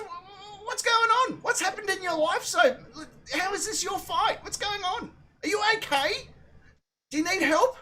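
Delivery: very high, baffled questioning; incredulous confrontation. Retold or imitated. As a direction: pester them with mock concern in a high voice. Retold confrontation, mock concern.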